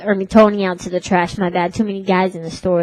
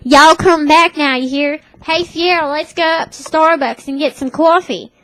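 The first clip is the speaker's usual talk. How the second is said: higher-pitched Southern twang from Tennessee; Tennessee accent; 5 semitones higher than usual. Retold or imitated. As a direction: imitate a friend's folksy Tennessee accent. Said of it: "she talks like this".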